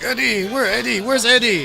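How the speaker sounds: put-on drunk in-laws calling.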